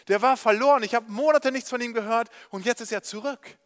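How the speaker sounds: a father bursting with joy, calling out orders; overjoyed orders. Acted. Vater gibt Diener begeistert Anweisungen. (Vater ruft seinen Dienern freudig Befehle zu, laut und begeistert)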